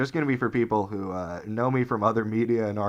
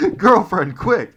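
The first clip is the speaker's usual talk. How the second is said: urgent call; 6 semitones higher, 6 dB louder, slower than usual. Retold or imitated. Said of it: "I go".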